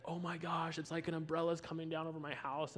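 acted amazement. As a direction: imitate a man seeing a vision. Retold man amazed by a vision.

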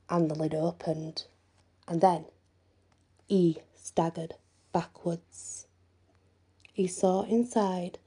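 ordinary delivery

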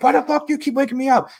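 high, fast angry squawk; high-pitched retold outburst; imitated screaming. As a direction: act the repeatedly woken sleeper. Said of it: "He's screaming at him".

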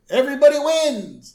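high, loud cheer; mock announcer cheer. The croupier announces the double-star win, high and loud. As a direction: announce it to the whole room.

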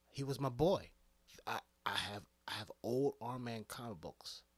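ordinary talk, nothing marked

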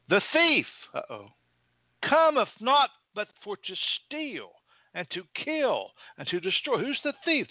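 high, ringing sermon reading; fervent preacher; preaching. Acted. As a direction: proclaim the verse, stretching it out. Preaching at full force.

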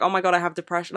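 usual speaking voice

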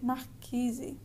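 "marquise" said slowly, the R breathy like an H; slow "marquise" with an aspirated R between syllables; Carioca R. Shown as a demonstration. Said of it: "when we put them together".

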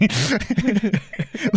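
high-pitched rising laugh; amused laugh. Real, not acted. Storyteller recalling his threat to the owner, real laugh. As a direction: laugh at your own cheeky line.

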